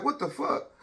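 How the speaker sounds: high, startled what the fuck; mock shock, voice jumping way up; retold disbelief. Handcuffed by police on his doorstep, high disbelieving shout. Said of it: "I'm like".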